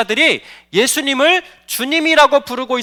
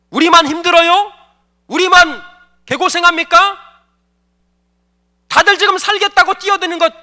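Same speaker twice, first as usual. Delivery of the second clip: higher, louder indignant complaint; angry protest; 5 semitones higher, 5 dB louder than usual. Acted. Disciples protest to the sleeping Jesus, loud and indignant. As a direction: hurl the grievance at him, rising. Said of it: "일종의 항변이었고 원망이었어요".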